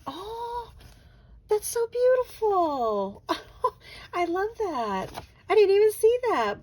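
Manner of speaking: high, loud, slow gushing over something lovely; gushing with delight. Real, not acted. Crafter discovering the stamp set's name, gushing with delight. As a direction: swoon over it, overcome with delight.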